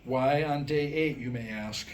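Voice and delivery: ordinary delivery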